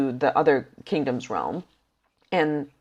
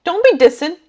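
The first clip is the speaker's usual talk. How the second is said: playfully defensive; 10 semitones higher, 9 dB louder than usual. Real, not acted. Defending a character's pants.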